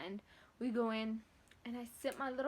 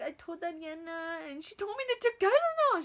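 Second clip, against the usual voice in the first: high, loud tattletale whine; mock whining; 6 semitones higher, 9 dB louder than usual. Daughter telling mom what her sister advised, mock whine. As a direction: wail the complaint in mock misery. Imitated complaint to mother.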